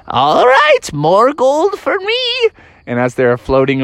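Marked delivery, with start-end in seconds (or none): 0.1-2.2 very high prospector crow; gleeful old miner. Acted. Old miner keeps the gold, high gleeful crow. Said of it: "the old miner was like".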